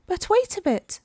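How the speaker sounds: high squeaky little voices; squeaky oysters. A narrator's reading. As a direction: cry out like tiny oysters. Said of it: "the oysters cried".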